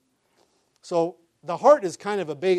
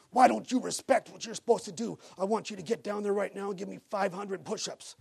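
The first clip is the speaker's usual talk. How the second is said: mock drill yell; 4 semitones higher than usual. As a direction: imitate a Marine chewing out a kid. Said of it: "just yelling and screaming".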